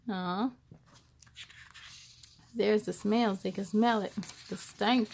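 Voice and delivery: plain reading voice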